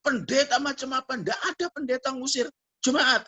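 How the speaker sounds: high-pitched indignant rant; imitated cursing out. Evicted girl berating the pastor, imitated angry scolding. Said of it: "Saya dimaki-maki dan dikatakan".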